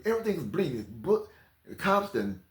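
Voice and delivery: usual speaking voice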